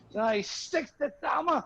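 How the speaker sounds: ordinary talk, nothing marked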